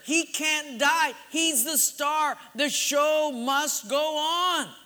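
high, slow emphatic declaration; dramatic insistence. Retold or imitated. Husband insists to his wife about Rambo, mock-dramatic insistence. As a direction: proclaim it phrase by phrase.

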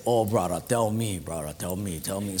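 low, softening Hawaiian pidgin; Hawaiian guy. Acted. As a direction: plead low like a laid-back Hawaiian brother. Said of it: "the Hawaiian guy say".

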